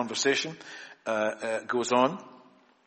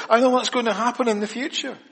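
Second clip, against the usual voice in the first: higher, quick and pleased with herself; a light, perky imitation of Martha; mock know-it-all Martha; 10 semitones higher, 5 dB louder, faster than usual. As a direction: play Martha as the eager top student. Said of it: "She gives to Jesus the perfect, theologically correct answer".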